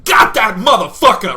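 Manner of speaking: high, very loud victory yell; triumphant scream. Retold or imitated. Gamer gets the wasp, triumphant scream. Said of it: "and I scream".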